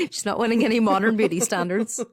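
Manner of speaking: giggly, amused retort; laughing. Real, not acted. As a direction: finish the line while still laughing. Laughing at cohost's joke.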